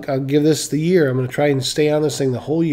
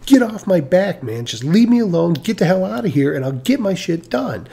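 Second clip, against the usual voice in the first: mock angry outburst; 5 semitones higher, 4 dB louder than usual. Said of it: "that's what I said up here".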